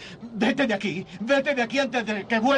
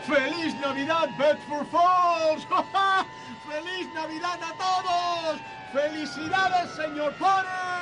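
joyful shouting; 5 semitones higher than usual. Acted. George running through Bedford Falls, shouting joyful Christmas greetings. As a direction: yell greetings to the whole town.

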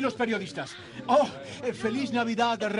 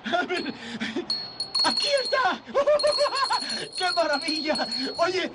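high, laughing exclamations of delight; overjoyed; 5 semitones higher than usual. Acted. George finding Zuzu's petals in his pocket, laughing with joy. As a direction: whoop with joy at finding them.

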